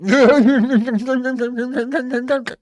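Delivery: high, hearty burst of laughter; a real, high mocking laugh. Real, not acted. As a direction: laugh out at him openly. Laughing at the rival's clip.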